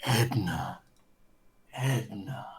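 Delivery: low eerie whisper; dark whisper behind you. Pretend. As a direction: breathe the name, low and creepy. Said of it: "And the Shadow whispered".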